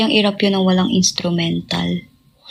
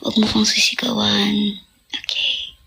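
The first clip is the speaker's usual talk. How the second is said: whispered into someone's ear; breathy hushed warning; 3 dB quieter than usual. Retold or imitated. Idol whispering to a fan who yelled at her. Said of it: "tas binubulungan ko".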